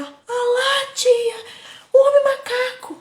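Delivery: put-on high, loud frightened little girl; child crying out to the teacher; scared child. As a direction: squeal it like a terrified kid. Child scared pointing at ape-man.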